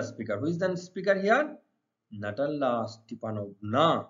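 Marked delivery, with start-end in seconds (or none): none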